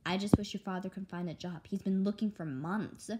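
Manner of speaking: usual speaking voice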